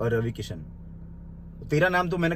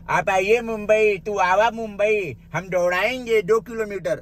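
slow, mocking quote of the rival, louder and higher; mocking imitation; 3 semitones higher, 4 dB louder, slower than usual. Mocking the rival's dare to come to Mumbai, a slow mock voice. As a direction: drag it out to make fun of his challenge.

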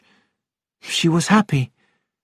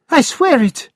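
higher, pleading vow of innocence; upset protest; 7 semitones higher than usual. Acted. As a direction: beg him to believe you. Maid protests she is innocent.

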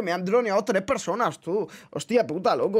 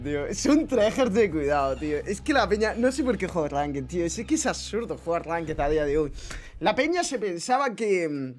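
normal talking voice

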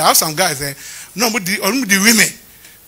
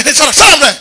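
mock snap; 7 semitones higher, 8 dB louder, faster than usual. Pastor retells himself snapping.

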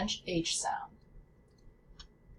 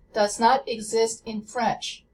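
normal talking voice

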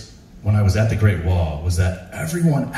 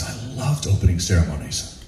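ordinary talk

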